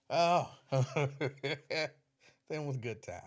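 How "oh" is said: ordinary talk, nothing marked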